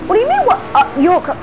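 incredulous angry shout; outraged yell. Acted. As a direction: explode in disbelief. Outraged at stalker's reply.

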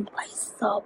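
hushed whisper of alarm; whispered bhai sahab; whispered exclamation. Real, not acted. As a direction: breathe it out in a scared hush. Scared at midnight.

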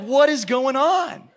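slow, high-pitched mock disbelief; baffled crowd. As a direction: draw it out as a bewildered follower.

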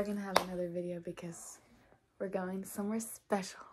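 secretive whisper. Real, not acted. Whispered teaser sign-off.

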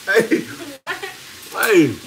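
normal talking voice

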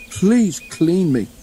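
slow plea. A narrator's reading. Sticky Thomas begs his crew, slow and pleading. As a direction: draw out a pitiful please.

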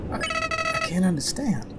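soft, high, faltering voice; small, broken bewilderment; overwhelmed villain. Acted. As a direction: falter quietly as if close to tears. Villain overcome by mercy.

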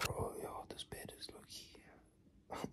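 soft unvoiced whisper. Real, not acted. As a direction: breathe it out as a whisper. Whispered aside in bed.

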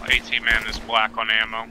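ordinary talk

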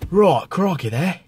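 broad mock Australian accent; hammed-up Australian accent. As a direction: play an Aussie wildlife guide, broad accent. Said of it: "here's my favourite accent, Australian".

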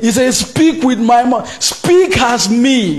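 loud, high, impassioned sermon delivery; fiery sermon voice; fiery preaching. Acted. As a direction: preach it with full force to the congregation. Preaching at full force.

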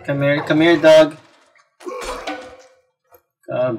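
ordinary talk, nothing marked

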